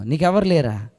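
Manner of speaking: ordinary talk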